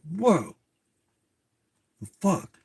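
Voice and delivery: high, amazed whoa the fuck; stunned whoa. Retold or imitated. Watching Twilight with the kid, amazed. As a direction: gasp like seeing a werewolf.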